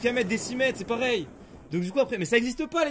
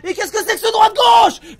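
much louder and higher indignant yell; outraged shout; 13 semitones higher, 17 dB louder than usual. Real, not acted. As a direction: shout out in disbelief. Outburst at the game. (cri indigné, beaucoup plus fort)

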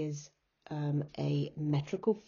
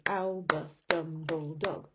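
"Albus Dumbledog" in slow, separated beats; measured counting out of "Albus Dumbledog"; syllable by syllable. Shown as a demonstration. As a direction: break up "Albus Dumbledog" as Al, bus, Dum, ble, dog. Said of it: "So five syllables".

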